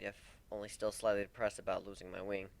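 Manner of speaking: plain reading voice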